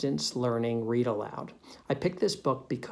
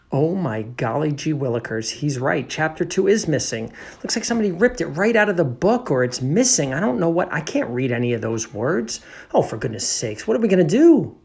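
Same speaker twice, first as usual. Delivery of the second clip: hammed-up dismay, building louder and higher; flustered alarm rising to a loud wail; mock panic; 4 semitones higher, 8 dB louder than usual. Teacher discovers the missing pages before pupils, mock panic.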